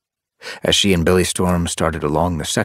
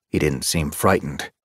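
ordinary delivery, nothing marked

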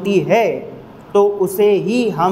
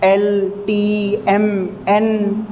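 slow, letter by letter names; slowly naming L, T, M, N as letters; spelled letter names; much slower than usual. Shown as a demonstration. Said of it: "letters के बारे में बात नहीं हो रही है".

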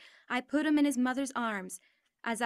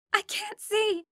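much higher and louder, frantic; panicked crying out; 10 semitones higher, 5 dB louder, slower than usual. Retold or imitated. Injured mother crying out at the crash, high panicked cries. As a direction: cry out in terror, blind and lost. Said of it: "she cried".

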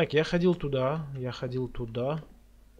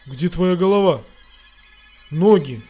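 startled yelling; 2 semitones higher, 9 dB louder than usual. Real, not acted. Headless ghost jump scare.

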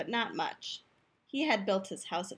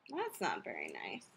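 much lower and softer aside; quiet disapproval; 9 dB quieter than usual. Acted. Wolf reacting to the granny insult, low quiet disapproval. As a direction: drop right down, soft and low.

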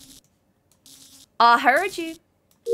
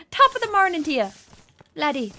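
put-on Irish accent; 2 semitones higher than usual. Irish accent greeting teammates in the game. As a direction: play a mock Irish greeting. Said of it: "I need to stop doing the accent".

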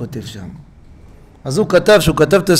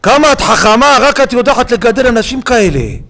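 much higher and louder, swept away with wonder; rapturous exclaim; 8 semitones higher, 6 dB louder than usual. Real, not acted. Preacher praising the Torah aloud, rapturous and much louder. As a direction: cry it out in awe.

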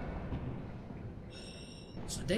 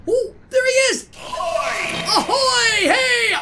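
loud, high pirate hail; excited greeting; 9 semitones higher, 6 dB louder, slower than usual. Real, not acted. Player hailing the pirate who just appeared, excited holler. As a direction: holler a thrilled hello.

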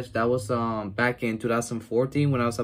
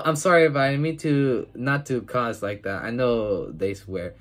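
normal talking voice

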